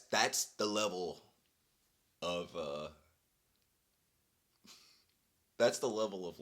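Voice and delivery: ordinary delivery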